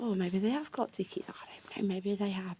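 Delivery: hushed whisper, half to herself; whispered thought. Retold or imitated. Wondering to herself whether they have tickets, whispered. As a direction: breathe it like a secret hope.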